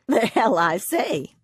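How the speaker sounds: snorting giggle. A narrator's reading. Wife teasing her husband before the doctor, snort-giggling. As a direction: tease him back with a snorting giggle. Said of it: "Lola snorted".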